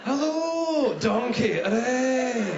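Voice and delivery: high, loud cartoon Scottish greeting; hammed-up Scottish ogre; Shrek and Billy Connolly mash-up. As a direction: whoop it out in broad Scottish. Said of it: "it sounds like Shrek shagging Billy Connolly".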